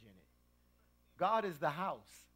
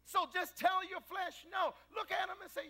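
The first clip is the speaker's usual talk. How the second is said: preaching; 14 semitones higher than usual. Real, not acted. Preacher urging the church to say no, shouting high. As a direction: drive it home to the congregation.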